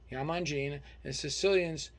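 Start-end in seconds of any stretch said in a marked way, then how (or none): none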